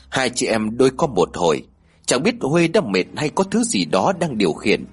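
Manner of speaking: ordinary delivery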